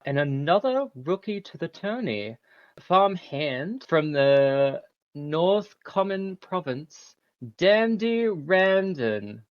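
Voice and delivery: lightly voiced tournament host; grand announcer. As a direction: proclaim to the crowd grandly. NPC announcer speech.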